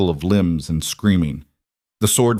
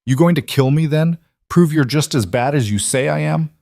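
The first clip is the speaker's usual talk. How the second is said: taunting; 6 semitones higher than usual. A narrator's reading. Pinned Marcus goading Rowan with her knife at his throat, taunting. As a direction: dare her to do it.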